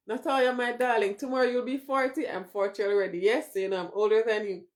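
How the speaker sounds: ordinary talk, nothing marked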